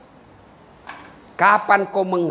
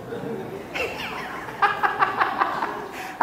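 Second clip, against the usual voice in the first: breathless high unvoiced laugh; airy laugh; 11 semitones higher, 7 dB quieter than usual. Real, not acted. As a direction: laugh silently at his own joke. Audience joke, preacher laughs. (tawa tertahan tanpa suara)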